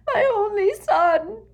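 very high, loud, drawn-out wail; slow, full-voiced howl of grief; sobbing wail.

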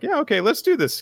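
high, gung-ho rallying cry; eager Suo. Retold or imitated. Suo rallying her captain, high and eager. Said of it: "Suo is like".

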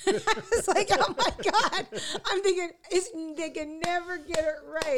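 high, loud, helpless laughter through the words; cracking up in disbelief; squealing with laughter, voice way up; laughing exasperation. Real, not acted. Laughing over botched podcast title.

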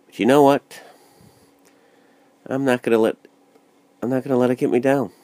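rally yourself out loud; defiant burst. Real, not acted. Defiant pep talk to self.

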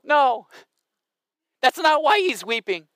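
ordinary talk

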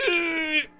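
high effortful groan of straining; strained grunt. Retold or imitated. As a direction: grunt as if pulling hard. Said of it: "you're like".

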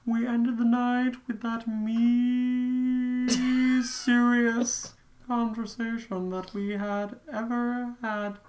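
high, drawn-out silly character voice; odd put-on voice. Reading aloud to a co-host in a strange high voice. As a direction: read it slowly in a high, sustained voice. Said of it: "what are these voices?".

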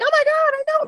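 high squealy mock "oh my God"; mock the people who just figured it out; mock realization.

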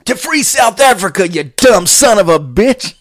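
high, loud, slow jeering yell; mock angry yell. Joe Pesci jumping in at the consulate, loud jeering yell. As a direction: bellow the insult. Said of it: "And he says".

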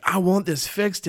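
a desperate plea, voice lifted; pleading cry. Retold or imitated. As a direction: cry out to be healed. Suffering people cry out.

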